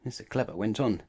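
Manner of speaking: ordinary delivery, nothing marked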